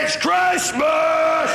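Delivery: slowly stretched excited yell; joyful shout. Acted. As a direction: cry it out like a kid on Christmas morning. Pope shouting in joy.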